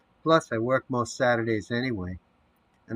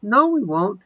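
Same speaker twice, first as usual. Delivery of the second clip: much higher and louder mock voice; 11 semitones higher, 8 dB louder than usual. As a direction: answer himself in a squeaky doubter voice. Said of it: "High voice".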